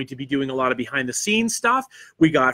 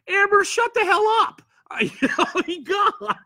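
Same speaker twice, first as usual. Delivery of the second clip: much higher, laughing mock scolding; laughing tease. Real, not acted. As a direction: scold her through laughter. Teasing a chat friend, laughing.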